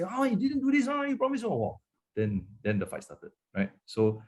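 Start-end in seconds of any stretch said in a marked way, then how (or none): 0.0-1.4 high whiny nagging, much higher pitched; nagging spouse mocked by the pastor. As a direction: mimic a scolding partner, pitched up high. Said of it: "the tone of voice".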